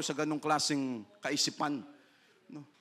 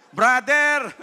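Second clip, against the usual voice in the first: calling out; 5 semitones higher, 8 dB louder than usual. Real, not acted. Calling out to congregation.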